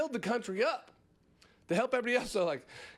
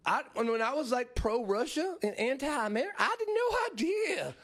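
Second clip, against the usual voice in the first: innocent voice climbing much higher to a squeaky no idea; mock Tim Pool; 5 semitones higher than usual. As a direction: play dumb, fake innocence. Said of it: "Tim Pool's like".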